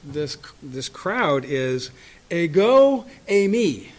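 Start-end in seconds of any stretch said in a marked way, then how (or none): 2.3-3.9 slow, loud Greek declaration; ringing pronouncement; forceful Jesus quote. Retold or imitated. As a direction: proclaim it slowly. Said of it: "But what Jesus said in response".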